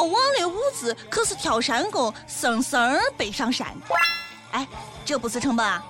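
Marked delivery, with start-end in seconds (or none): none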